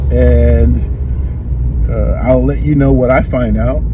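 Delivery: ordinary talk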